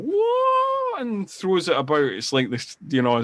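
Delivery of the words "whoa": high drawn-out "whoa"; mock martial-arts war cry. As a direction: mimic a kung fu yell on a rising wail. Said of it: "kind of martial art kind of".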